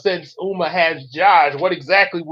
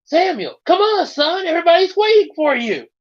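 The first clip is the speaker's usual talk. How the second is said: mother calling; 12 semitones higher than usual. A narrator's reading. Mother calls son to breakfast.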